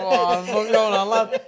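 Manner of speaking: full Scouse impression. Lad talking to his mates, exaggerated loud Scouse. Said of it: "talks full Scouse".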